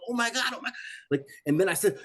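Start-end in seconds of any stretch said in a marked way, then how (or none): 0.1-0.7 panicked gasp. Retold or imitated. Panic before the pit.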